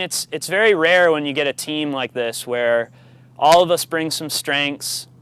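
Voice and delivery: ordinary talk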